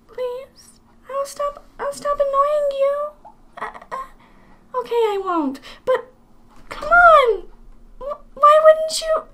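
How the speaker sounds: very high, softened whimpering character voice; pleading. Acted. Companion begging to get under Link's hat, pleading. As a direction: beg in a small high put-on voice.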